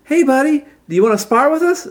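put-on high-pitched girl challenger; perky high character voice; Tawny's voice.

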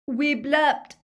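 high, loud, thick-tongued answer; stuffed-up consonants, pitched high; mouth full of blood. A narrator's reading. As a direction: blurt it through a bleeding mouth. Ron speaks with bleeding mouth.

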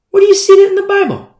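very high, loud, fast rebuttal; incredulous challenge. Real, not acted. Preacher rebuts the different-saints argument, incredulous challenge. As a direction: fire it back in disbelief.

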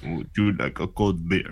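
put-on Russian accent. S1 mimics the neighbor's line.

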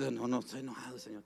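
mildly acted, quiet cover-up; sheepish denial. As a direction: play down the anger to God. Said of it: "Y usted disimula".

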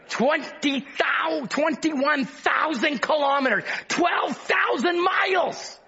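fervent pulpit cry building on each line; preaching. Acted. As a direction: preach it out to the congregation. Preaching at full force.

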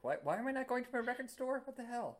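high, incredulous outburst; mock exasperated. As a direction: throw up your hands in disbelief. Exasperated at own bad deal.

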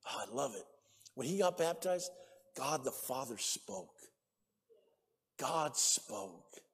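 low, soft, reverent preaching voice; hushed awe from the pulpit. Real, not acted. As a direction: drop low and quiet, pausing between phrases. Preacher hushes to recall God speaking.

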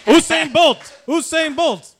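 shouted, high chant of a name; hype call. Acted. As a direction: bellow it like a stadium crowd. Hyping a Jamaican guest.

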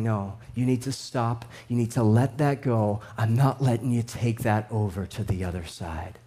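a low, quiet firm command; God speaking firmly. Acted. God voiced telling to let go.

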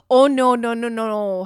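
sassy correction. Acted. To her past self, correcting her with sassy pity.

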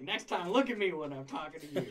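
can't hold back a laugh; quoting through laughter. Retold or imitated. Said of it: "He's like".

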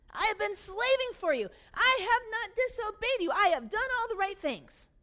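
high, loud, escalating self-defence; loud righteous indignation; furious protest. Acted. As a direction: raise it to an aggrieved shout. Older brother escalates at father.

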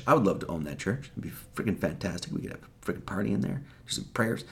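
fast hushed joke about partying in a church; joking aside. Real, not acted. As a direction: toss it off under your breath.

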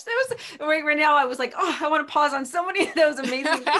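high, loud gushing; excited. Real, not acted. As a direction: burst out with delight. Excited host reaction.

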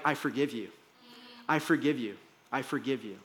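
gently, quietly forgiving; hushed and tender, like Jesus to Peter; soft forgiveness. Retold or imitated.

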